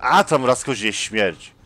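loud, slow-stretched rallying shout; go-for-broke cry. Real, not acted. Player leaving the hiding place despite danger, go-for-broke cry. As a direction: throw caution away, loudly. Player decides to risk running out.

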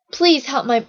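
beg, leaning on PLEASE; pleading. A narrator's reading. Steffi begging the boy for help, pleading. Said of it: "Steffi pleaded".

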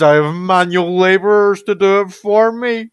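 gloating villain, rising high and loud; scheming mad scientist boasting. Pretend. Gloating over villager laborers.

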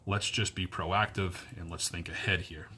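usual speaking voice

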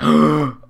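loud sudden intake of breath; startled loud gasp; sharp gasp. Real, not acted. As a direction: gasp hard in suspense. Near miss in game.

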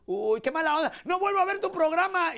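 high, put-out fan whining about the show; offended fan. Retold or imitated. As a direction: play the hurt viewer, lightly mocking.

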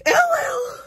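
high mock wail on oh well; dramatic high wail. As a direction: wail it like a diva. Dramatic sigh of mock despair.

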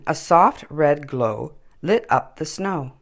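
usual speaking voice

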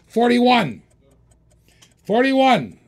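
shouted high and loud; sudden auctioneer shout; excited bid call. Real, not acted. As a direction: belt out the new bid. Auctioneer calls a new bid.

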